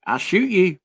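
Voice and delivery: mock Kentucky local threatening, hammed-up; mock Kentucky threat. Joking about Kentuckians answering a word, mock Kentucky accent. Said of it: "offended by the Kentucky accent or jokes".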